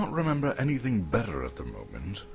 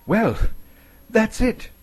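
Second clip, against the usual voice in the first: much higher, louder hobbit voice; Bilbo; 7 dB louder than usual. Acted. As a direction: blurt the answer out high and proud.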